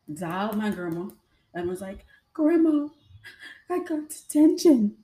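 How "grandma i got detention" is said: high, loud wailing through tears; retold crying wail. Teen wailing to grandma on the phone, crying high and loud. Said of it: "Why are you crying?".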